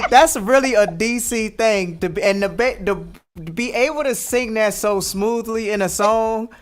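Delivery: high, loud, laughing praise; excited laughing. Real, not acted. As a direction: cheer her on through laughter. Delighted praise for the lyric.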